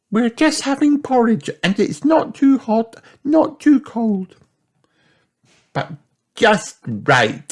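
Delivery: put-on very high, childlike storybook voice; playful little girl character. Child chats to her friend about porridge, playful high voice. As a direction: pitch it right up like a small cartoon child.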